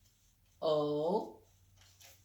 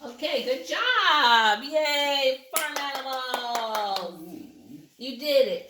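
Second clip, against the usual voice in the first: excited cheering; 5 semitones higher, 5 dB louder than usual. Real, not acted. Parent cheers child finishing spelling.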